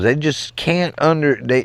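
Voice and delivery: ordinary talk, nothing marked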